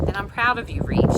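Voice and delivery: husky, emotional, loud; mother unexpectedly praising her son. Acted. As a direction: choke out the praise. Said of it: "she said huskily".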